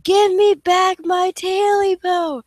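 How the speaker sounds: slow, loud ghostly shriek. Acted. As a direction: shriek it like a spirit demanding back its own. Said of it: "the voice grew louder and louder".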